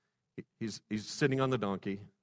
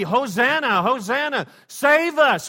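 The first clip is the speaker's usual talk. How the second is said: Hosanna, Hosanna cried out loud and high; exultant cry of praise; joyful shout; 12 semitones higher, 10 dB louder, slower than usual. Shown as a demonstration. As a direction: shout it like the crowd. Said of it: "It ought to be".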